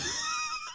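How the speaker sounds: quick squealing laugh; short high burst of laughter; real quick laugh. Real, not acted. Cohost laughing.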